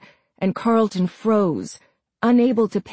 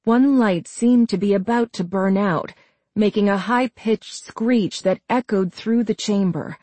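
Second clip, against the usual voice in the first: plain reading voice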